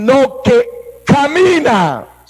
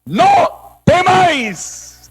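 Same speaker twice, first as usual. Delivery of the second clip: high, slow proclamation rising to a shout; commanding call; 8 semitones higher, much slower than usual. Acted. Preacher voicing Jesus calling to the disciples, booming shout. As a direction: ring out over the storm. Repeating Jesus' words to congregation.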